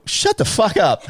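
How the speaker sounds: high-pitched retold burst of disbelief; astonished disbelief. Son to UFC boss on the phone, astonished disbelief. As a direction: re-enact being stunned, pitch jumping up. Stunned reply to celebrity caller, retold.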